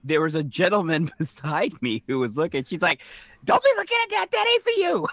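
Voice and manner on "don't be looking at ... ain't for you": very high-pitched retold scolding; mock scolding. Woman warns a gentleman looking at her, high-pitched mock scolding. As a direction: imitate her telling off an onlooker, way up high.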